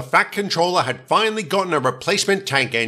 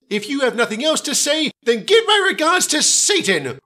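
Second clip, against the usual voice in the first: high, heated villain's ultimatum; high-pitched vengeful declaration; menacing threat; 12 semitones higher than usual. A narrator's reading. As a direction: deliver a theatrical final threat. Percy threatens his tormentors in story.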